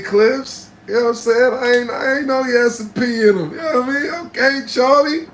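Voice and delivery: very high, loud flirtatious voice; hammed-up flirty shout-out; flirty teasing. Real, not acted. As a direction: tease him like a flirt. Flirty teasing of Charlie Clips.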